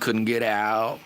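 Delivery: usual speaking voice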